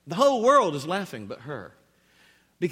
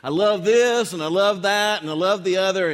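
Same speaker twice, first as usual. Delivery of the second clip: loud, higher mock gushing; gushing beer lover; 5 semitones higher, 6 dB louder than usual. Preacher imitates alpha male in beer ad, gushing loudly. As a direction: rave on and on like the ad guy. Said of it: "he's just going on about".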